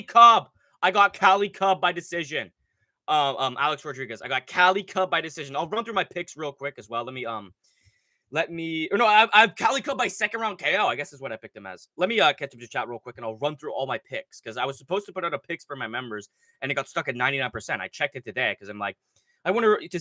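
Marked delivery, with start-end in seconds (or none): none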